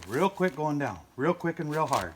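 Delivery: firm, loud call to push; loud urging. Real, not acted. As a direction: drive them to go quick and hard. Urging a helper during assembly.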